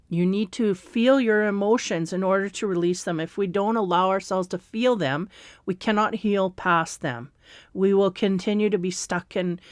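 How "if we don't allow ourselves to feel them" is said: ordinary talk, nothing marked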